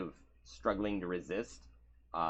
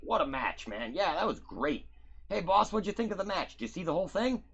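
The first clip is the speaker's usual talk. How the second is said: higher, louder buddy banter; cheerful wrestlers; 11 semitones higher, 5 dB louder than usual. Acted. Wrestlers joking with the boss after the match, pumped and cheery.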